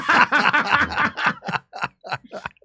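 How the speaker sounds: high burst of laughter. Real, not acted. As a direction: laugh out at the comparison. Laughing at own comparison.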